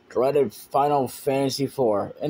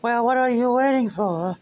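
high impatient voice; 11 semitones higher than usual. Acted. Impatient character voice.

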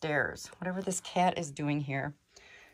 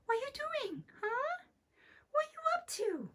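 pet talk; 5 dB quieter than usual. Real, not acted. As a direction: sweet-talk the little creature. Talking to an animal in attic.

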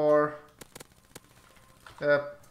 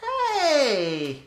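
calling out; 11 semitones higher than usual. Real, not acted. Calling out to teammate.

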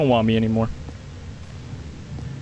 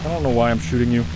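lower, mildly cold-blooded killer voice; a hint of a low, cold killer; 6 semitones lower, 3 dB quieter than usual. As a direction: drop the voice, play it cold. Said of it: "I'm a cold-blooded man".

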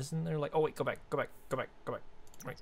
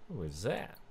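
ordinary talk, nothing marked

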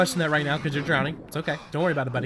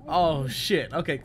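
ordinary talk

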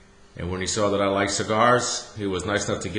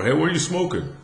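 raised, louder call across the range; called-out question; 7 semitones higher, 4 dB louder than usual. Retold or imitated. Calling across to a golfer about his cigar, louder. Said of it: "that's when I screamed over".

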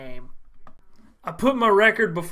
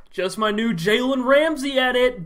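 ordinary talk, nothing marked

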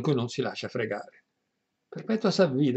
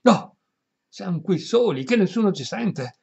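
eager reassurance; 7 semitones higher, 5 dB louder than usual. Acted. Perpetua reassures, loud and high.

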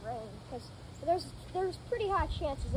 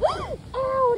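high yelp of pain; sharp ow; 12 semitones higher, 11 dB louder than usual. Real, not acted. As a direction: yelp at a sudden nip. Pod bit her nail.

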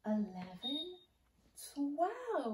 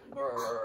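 bear growl; 11 semitones lower than usual. Shown as a demonstration. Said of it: "Move like a bear".

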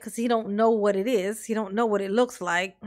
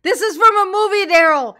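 higher, louder incredulous exclamation; 7 semitones higher, 8 dB louder than usual. Real, not acted. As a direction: call him out loudly, pitched up. Exasperated repeat at Daryl's speech.

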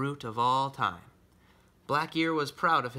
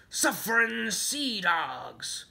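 high, drawn-out pirate outcry; pirate exclamation; 7 semitones higher, 4 dB louder, much slower than usual. Acted. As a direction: stretch out the pirate oath in alarm. Pirate exclaims as storm strikes.

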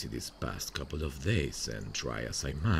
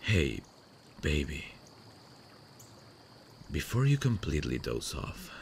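ordinary talk, nothing marked

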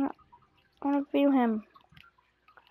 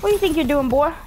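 loud, higher mock telling-off; mock scolding; 4 semitones higher, 6 dB louder, faster than usual. Player to enemy who took his spot, mock scolding. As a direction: scold an opponent, playfully outraged.

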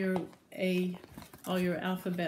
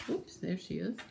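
usual speaking voice

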